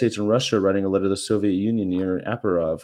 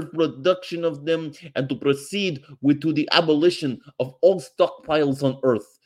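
pompous mock Russian accent, pitched up; mock Soviet leader; 4 semitones higher than usual. Vlogger reading Andropov's reply as a pompous Soviet leader. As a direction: read it like a grand Soviet decree.

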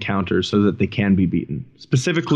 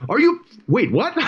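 high puzzled mock instructor yell; confused drill instructor; 12 semitones higher than usual. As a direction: stop mid-yell, baffled. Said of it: "starts shouting in his face".

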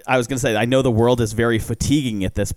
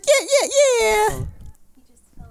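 high squealing excitement; excited squeal; 4 dB louder, much slower than usual. Real, not acted. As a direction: squeal with joy over a puppy. Reacting to puppy news.

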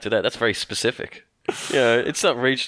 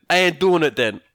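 put-on louder, higher New York accent; Tony Danza; 5 semitones higher, 5 dB louder than usual. Actor refusing the movie role, stubborn New York accent. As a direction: refuse point-blank in New York tones. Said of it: "a specific thing he wants to do".

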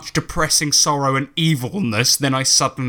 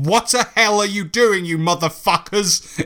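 put-on higher, outraged old headmaster voice; a hammed-up scandalised old wizard; outraged Dumbledore; 7 semitones higher than usual. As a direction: catch the lovers in the act with a squawking old-man yell. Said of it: "It was Dumbledore".

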